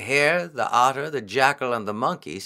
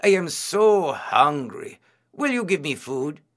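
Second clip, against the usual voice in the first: crying out in hunger; 4 semitones higher than usual. A narrator's reading. Disguised priest begging the monkey for food, pleading. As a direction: stretch the hunger into a mild plea. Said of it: "he cried".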